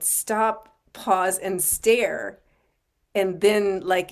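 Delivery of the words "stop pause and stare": drawn out, each beat spaced; slow deliberate. Retold or imitated. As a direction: spell out the stop, the pause, the stare slowly. Acting out people stopping to stare.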